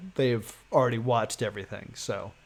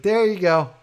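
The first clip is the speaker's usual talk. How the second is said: higher, louder chirpy cashier voice; breezy cashier; 7 semitones higher, 9 dB louder than usual. Retold or imitated. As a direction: play the cheerful cashier handing cash. Cashier hands over two hundred.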